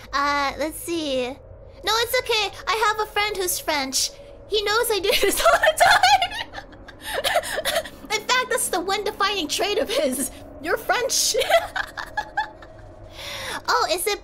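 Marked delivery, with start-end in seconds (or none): 4.5-8.1 higher, louder, laughing through the words; breaking into laughter. Real, not acted. Streamer admitting she teases her French friend, breaking into laughter. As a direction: crack up mid-sentence.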